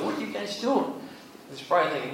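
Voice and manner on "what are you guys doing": higher-pitched, incredulous questioning; exasperated father. Acted. Jacob confronting his sons, exasperated father. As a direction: question them like a baffled dad. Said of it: "Jacob's probably looking at him".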